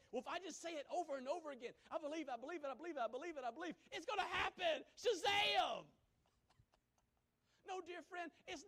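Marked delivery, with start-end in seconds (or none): 0.1-4.8 higher, louder mock chant of a naive believer; mocking Christians who repeat it over and over, higher mock voice. Preacher imitates Christians chanting, high and loud. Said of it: "Christians just think". 5.0-5.8 high, loud comic shout; boom, like a magic word; mock shout. Preacher mocking magic faith with a high loud shout.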